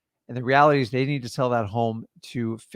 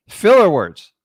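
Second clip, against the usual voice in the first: loud announcement; 4 semitones higher, 11 dB louder, slower than usual. Real, not acted. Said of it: "announcing topic loudly".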